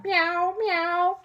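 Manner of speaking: very high cat meow; a high mock cat cry. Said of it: "heard Saucepan Man meowing".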